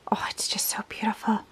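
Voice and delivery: soft, whispered admiration; hushed awe. Real, not acted. Unboxing a garland for her daughter's room, hushed awe. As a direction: breathe it out, genuinely moved. Overcome by the garland she unpacked.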